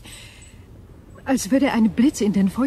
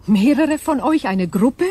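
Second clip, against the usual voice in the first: raised, higher alarmed cry; alarmed outburst; 5 semitones higher, 7 dB louder than usual. Acted. Berenice crying out in alarm at the thought. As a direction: burst out in alarm. Crystal imagines many attackers.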